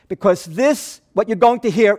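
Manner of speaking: ordinary talk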